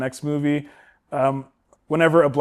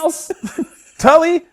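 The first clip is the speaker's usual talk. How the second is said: much higher, startled "Miles? Tully?"; mock surprised recognition; 14 semitones higher, 5 dB louder than usual. Host retelling the robber recognizing Miles, high mock surprise. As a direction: act the shock of seeing an old friend.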